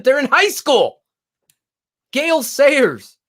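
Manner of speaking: building outrage, voice climbing; indignant rant. Real, not acted.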